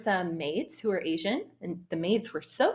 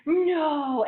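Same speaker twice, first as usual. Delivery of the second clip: higher, louder cry of disbelief; stunned no; 10 semitones higher, 7 dB louder than usual. Retold or imitated. Waiters hearing she turns thirty-two, loud stunned cry. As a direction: blurt it out in disbelief. Crew reacts to her age.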